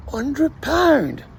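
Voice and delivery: high, incredulous echo of "Hundred pound"; stunned repeat of the price; amazed. Retold or imitated. Soldier hearing the lady's offer, high disbelieving echo.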